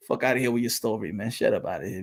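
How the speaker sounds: low, dismissive brush-off; dismissive mutter. Retold or imitated. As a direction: wave off the story, low and soft. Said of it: "you look at them like".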